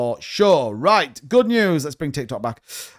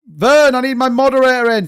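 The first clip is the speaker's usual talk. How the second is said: louder, higher urgent call; calling for help; 7 semitones higher, 6 dB louder than usual. Real, not acted. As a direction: summon your moderator right now. Calls mod against scammer.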